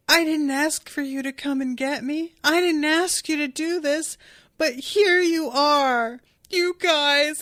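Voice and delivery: high, tearful protest, acted out; mock crying. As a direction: imitate someone protesting through tears. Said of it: "he just starts crying".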